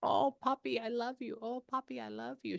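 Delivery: tender relaying. Retold or imitated. Medium relaying a grandmother's love for Poppy, softly tender. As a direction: voice someone else's fond words, high and hushed.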